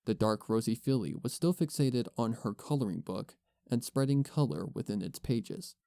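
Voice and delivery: ordinary delivery, nothing marked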